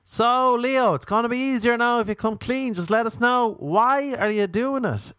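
high, put-on Dublin boss voice; Dublin accent. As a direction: press for a confession in a Dublin accent. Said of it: "I could do a Dublin one".

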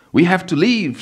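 panting it out in panic; panicked scream. Acted. Wife screaming at her husband to flee, panicked. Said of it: "she screamed, panting and puffing".

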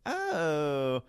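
put-on ditzy teen asking, voice floating up; valley girl voice. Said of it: "This is how she talked".

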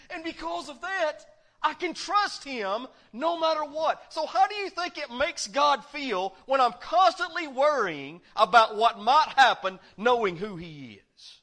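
full-force preaching. Acted. Preacher booming scripture to the congregation, full force. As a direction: preach the verse out to the whole church.

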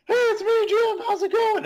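squeaky high puppet voice; goofy high-pitched puppet character. Pretend. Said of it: "I was gonna just do, like, a puppet voice".

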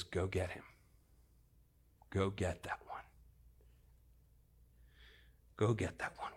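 low, hushed, deliberate instruction; single someone out softly, with long pauses; very soft, tender authority; quiet command. Acted. Jesus stops, quietly sends for him.

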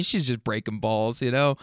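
ordinary talk, nothing marked

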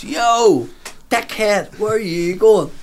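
high, loud call across the street; yelled taunt. Retold or imitated. Drinker shouting at a passing lad: loud mocking call. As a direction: shout down the hill to mock him. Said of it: "the one with a tin of beer goes".

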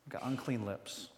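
soft, low, hushed admission; broken confession. Retold or imitated. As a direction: confess it almost under your breath. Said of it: "That's the way he says it".

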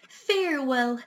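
plain reading voice

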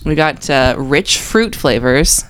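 ordinary delivery

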